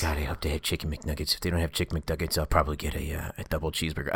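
hoping under his breath; inner thoughts. Pretend. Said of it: "it's always in this voice".

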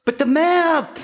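high, slow, whining complaint; whiny protest. Acted. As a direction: whine it like a stubborn kid. Staged argument over directions.

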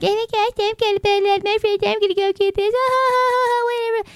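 very high, sing-song mockery of a Bud Light fan; hammed-up high-pitched parody of the fans; mocking ditzy voice. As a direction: squeal it out like a giddy airhead, with a giggle. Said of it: "they're all making fun of these, like".

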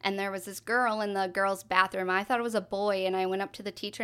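usual speaking voice

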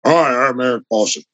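changed, gravelly self-introduction, softly dropped. Shown as a demonstration. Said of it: "my voice changed a little bit".

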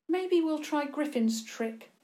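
put-on high, airy young woman's voice; lightly. A narrator's reading. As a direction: toss it off as a casual suggestion. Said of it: "Clara said lightly".

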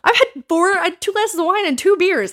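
loud, high tipsy rant. Retold or imitated. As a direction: rant like you've had a few. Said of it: "I'm drunk".

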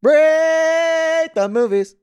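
high, loud, drawn-out call; high slow announcer. Acted. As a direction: stretch it out like a grand announcement. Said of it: "echoing chant".

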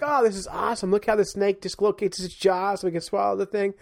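high-pitched, gleeful fascination; thrilled naturalist, retold; retold excited marvelling. Retelling his delight at the snake.

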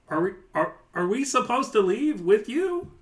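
high, puzzled goblin asking if they should leave; a henchman unsure of orders, voice rising in doubt; confused goblin minion. Acted. Goblins asking the lieutenant whether to leave, high and unsure. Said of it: "look back at the lieutenant like".

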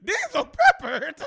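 high, loud burst of laughing incredulity; cracking up at the name; laughing disbelief. Real, not acted.

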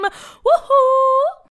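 very high, loud woohoo; cheer. Real, not acted. Host cheering for the birthday kids, high and loud. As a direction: whoop with joy.

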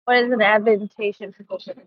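normal talking voice

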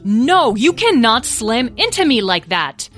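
loud, high scolding mother; exasperated parental bellow, building. A narrator's reading. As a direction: bellow at a child to get off, rising in pitch. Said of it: "Beatrice bellowed".

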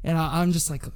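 normal talking voice

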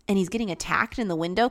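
normal talking voice

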